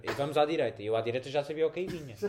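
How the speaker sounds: ordinary talk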